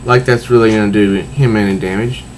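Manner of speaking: ordinary talk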